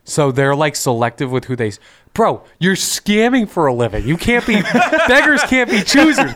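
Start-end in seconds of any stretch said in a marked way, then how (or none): 2.1-6.3 laughing. Real, not acted. Mocking scammers, high and laughing.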